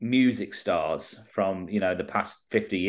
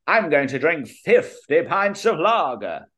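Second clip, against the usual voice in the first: higher, louder put-on celebrity voice; half-managed diva impression, pitched up; 5 semitones higher, 3 dB louder than usual. Pub anecdote quoting a star, same generic put-on voice. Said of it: "I'll just do the same sort of voice".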